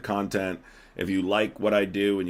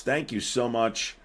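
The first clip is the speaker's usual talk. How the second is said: normal talking voice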